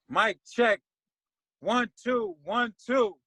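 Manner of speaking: lightly voiced rapper at the mic, drawn out; a hint of a stage MC warming up. Imitating the MC on stage.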